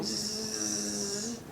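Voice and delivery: soft high buzzing hiss; a thin, soft zzz like electricity through the body; quiet electric buzz; imitated tingling buzz. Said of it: "I can feel this tingling".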